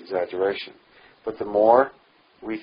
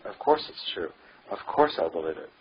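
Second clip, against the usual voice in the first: usual speaking voice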